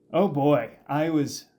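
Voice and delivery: usual speaking voice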